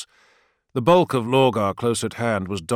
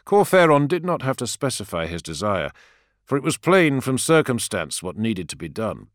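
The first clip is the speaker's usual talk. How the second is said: usual speaking voice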